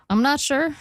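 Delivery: ordinary delivery, nothing marked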